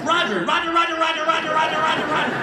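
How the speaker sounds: high rapid-fire monotone "Roger"; panicked pilot babble. Acted. Shaken passenger to pilot, high mock-panic babble. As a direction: rattle off "Roger" over and over, high and frantic.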